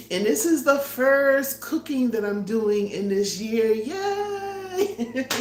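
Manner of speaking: high, drawn-out cheer on the last word; excited announcement rising into a long yay; celebratory. Real, not acted. Vlogger celebrates the year's first cooking, excited.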